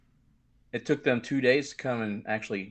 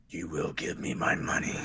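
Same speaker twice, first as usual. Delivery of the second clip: low threatening whisper; menacing whisper; 7 semitones lower, 5 dB quieter than usual. Retold or imitated. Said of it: "you did that a little too well".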